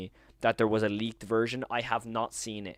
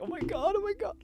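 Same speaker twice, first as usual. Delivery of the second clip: squeaky falsetto, much higher, mock-hysterical; squealing fanboy; faster than usual. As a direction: squeal it like an overexcited fan. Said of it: "I'm gonna be like".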